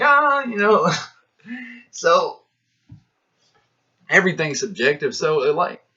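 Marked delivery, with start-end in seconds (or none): 0.0-0.4 high loud mock "ah"; hammed-up shrugging ah; dismissive ah. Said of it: "they be like".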